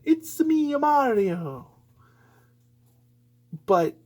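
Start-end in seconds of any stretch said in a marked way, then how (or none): 0.0-1.7 high put-on Mario catchphrase; Mario impression. Shown as a demonstration. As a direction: chirp the catchphrase in the plumber voice. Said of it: "he can do that voice".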